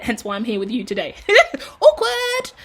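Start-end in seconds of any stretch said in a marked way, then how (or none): 1.2-1.8 high squealing laugh; embarrassed giggle breaking out; awkward laugh. Real, not acted. Awkward laugh at own joke. 1.8-2.5 high, loud mock-awkward cry; playful high exclamation; awkward squeal. Real, not acted. Self-mocking after own joke.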